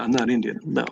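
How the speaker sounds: ordinary talk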